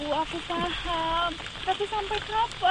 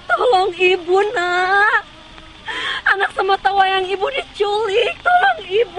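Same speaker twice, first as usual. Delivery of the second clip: louder, sobbing appeal for help; crying plea; 2 semitones higher, 11 dB louder than usual. Acted. Mother begs a youth for help, crying. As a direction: plead through tears.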